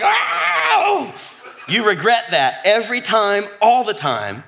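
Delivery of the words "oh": very high, loud laughing shriek; shrieking cry. Pretend. Preacher imitating the regret, like baptizing a cat, shrieking. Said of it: "It's like".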